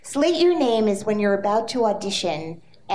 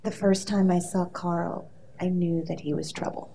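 dramatic read; 5 semitones lower, 6 dB quieter than usual. Shown as a demonstration. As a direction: read it like a soap opera line. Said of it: "I wanna give you a piece of dialogue".

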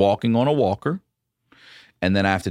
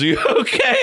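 laughing; 4 dB louder, faster than usual. Real, not acted. Retelling collapse, laughing.

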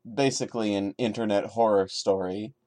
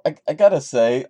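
ordinary delivery

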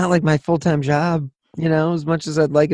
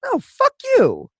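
mock high retort; slower than usual. Retold snapping at a troll.

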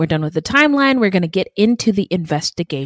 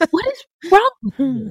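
high-pitched mock exasperation; incredulous scolding; 10 semitones higher than usual. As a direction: squeak it out in amused disbelief. Incredulous question to the absent woman.

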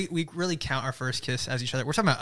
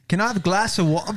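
a clumsy attempt at an Australian accent, slightly higher; forcing a fake Australian accent, half embarrassed; bad Australian accent; 4 semitones higher than usual. Shown as a demonstration. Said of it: "Just close your eyes and go for it".

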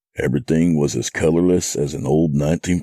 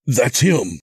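urgent, higher exclamation; crying out; 9 semitones higher, 3 dB louder than usual. A narrator's reading. Narrator spots the man on screen, crying out. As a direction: cry out in sudden recognition. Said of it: "I cried".

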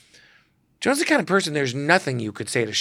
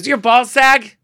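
much higher, louder brassy call; Joan Rivers; 11 semitones higher, 10 dB louder than usual. Retold or imitated. Joan calling the photographer mid-shoot, brassy shout. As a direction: bark his name like a diva. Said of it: "She goes".

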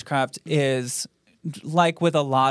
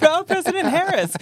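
high teasing imitation of a clueless guess; mocking imitation; 5 dB louder than usual. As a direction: parrot it back again, still mocking. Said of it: "You tried".

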